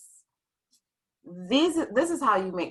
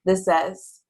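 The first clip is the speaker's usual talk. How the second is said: ordinary talk, nothing marked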